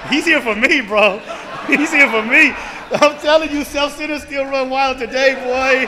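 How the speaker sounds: loud joyful testimony; fired up. Real, not acted. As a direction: lift the room with it. Preaching gratitude to meeting.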